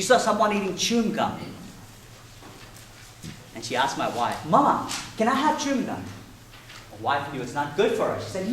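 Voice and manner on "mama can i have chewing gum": lightly voiced little girl asking; higher little-girl plea; child voice. Daughter asking her mama for chewing gum, lightly childlike.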